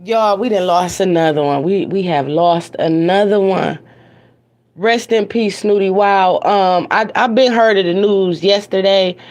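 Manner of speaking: ordinary delivery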